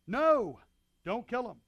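loud, high urgent cry of no; urgent shout. Retold or imitated. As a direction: stop him with a sharp shout. David stops killing Saul.

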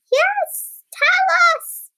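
very high, loud pleading voice; eager worm. A narrator's reading. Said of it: "said the bone-eating stock flower worm".

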